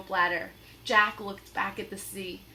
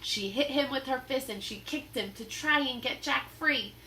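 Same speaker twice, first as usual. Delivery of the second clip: ordinary delivery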